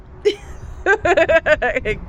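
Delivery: high, crying laugh; joyful laugh. Real, not acted. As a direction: let a teary laugh of relief out. Laughs after the good news.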